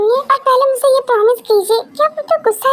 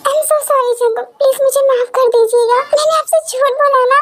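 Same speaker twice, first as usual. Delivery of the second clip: put-on tearful girlfriend, voice raised higher, begging for a reply; tearful pleading; 6 semitones higher than usual. Girlfriend begs silent [name] to forgive her, tearful and pleading. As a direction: plead through tears for him to speak. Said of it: "Stop it, रोना बंद करो।".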